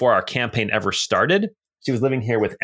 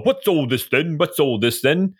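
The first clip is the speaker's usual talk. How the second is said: lightly voiced British bobby, a hint of a cockney accent; a mild British constable, asking what's going on; 2 semitones higher than usual. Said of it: "doing that whole constable thing".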